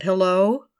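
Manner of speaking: loud, wary hello into the phone; tense hello. Acted. Picking up the receiver for an unknown caller, wary. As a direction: call out to a silent line.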